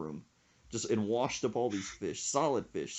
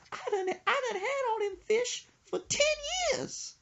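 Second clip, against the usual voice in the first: put-on high old lady voice through a laugh. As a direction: squeak it out as her, half laughing. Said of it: "plus she said".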